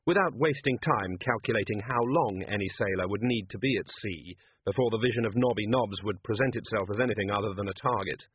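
usual speaking voice

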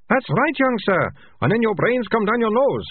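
high, squeaky sidekick rat catcher. A narrator's reading. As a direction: play the giggly second rat catcher, way up high.

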